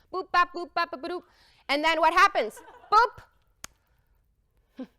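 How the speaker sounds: playful nonsense syllables standing in for speech; high playful boop sounds; mock chatter noises. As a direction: bop along like chatter, last one high. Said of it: "talking to them in Danish".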